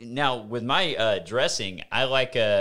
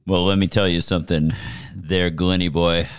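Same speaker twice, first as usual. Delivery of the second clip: sexy voice; 6 semitones lower, 5 dB louder than usual. Pretend. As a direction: purr it at him teasingly. Keeps up the sexy voice bit.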